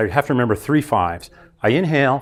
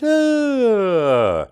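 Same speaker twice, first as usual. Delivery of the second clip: a yawning "uh" sliding down from high; high yawn sound; 9 semitones higher than usual. Shown as a demonstration. Speaker showing the audience a yawn, high sliding yawn. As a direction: yawn it out from the top of the range. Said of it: "we actually start with a fairly high pitch".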